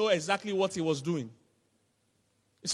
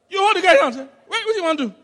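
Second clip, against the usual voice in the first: loud alarmed cry, much higher; panicked shout; 10 semitones higher, 8 dB louder, faster than usual. Retold or imitated. Patient on the table panicking at the knife, loud alarmed cry.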